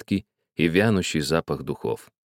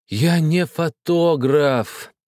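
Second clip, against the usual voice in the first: slow, higher, moaning denial; hammed-up despairing moan; 7 semitones higher, much slower than usual. A narrator's reading. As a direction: groan it out in despair. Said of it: "простонал я в отчаянии".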